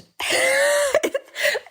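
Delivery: high, breaking laugh-sob; a sob that tips into a laugh; laugh-cry. Real, not acted. Emotions hit, crying-laughing.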